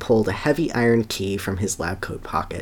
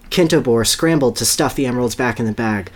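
normal talking voice

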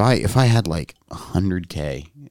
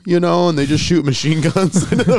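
park ranger voice; 10 semitones higher, 5 dB louder than usual. Retold or imitated. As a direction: play the weary ranger, pitched up. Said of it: "And the park ranger's like".

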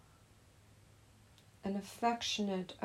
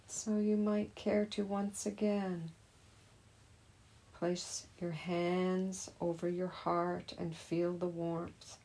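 normal talking voice

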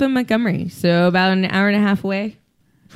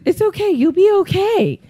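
mock soothing student; 11 semitones higher than usual. Retelling the tandem student comforting her instructor in the plane, higher mock soothing. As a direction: coo it like a babysitter calming a child, higher. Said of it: "And she goes".

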